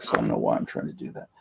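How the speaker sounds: keeping his voice down, quick and low; hushed voice. Real, not acted. Late-night streamer keeping his voice down, quiet and quick. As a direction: hush it so as not to wake anyone. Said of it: "I'm trying to keep my voice down".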